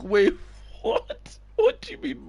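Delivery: squeaky disbelieving what; incredulous coach on the phone; mock shock. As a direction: act stunned, voice jumping way up.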